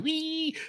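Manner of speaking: imitated high-pitched "wee" ringing; thin, very high mimicked whine; high tinnitus tone. Shown as a demonstration. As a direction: squeal a high tone like ringing ears. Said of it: "One's high".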